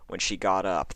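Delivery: ordinary delivery, nothing marked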